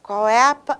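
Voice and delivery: usual speaking voice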